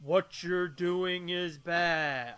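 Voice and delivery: very slow, solemn monster voice; slow giant speech. Retold or imitated. Quoting Swamp Thing's speech to the cohost, very slow and solemn. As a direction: drag out each word like a towering swamp creature. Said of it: "long, slow speech".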